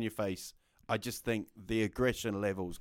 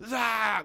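sudden loud high shout; mock lunging shout; 11 semitones higher, 5 dB louder than usual. Retelling a man lunging at him, sudden shout. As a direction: burst out like a lunging attacker. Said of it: "like that".

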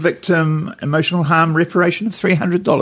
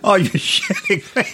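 laughing; 6 semitones higher than usual. Real, not acted. Both laughing at meth gators story.

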